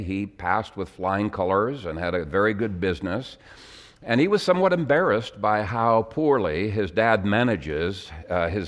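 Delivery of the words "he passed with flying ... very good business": normal talking voice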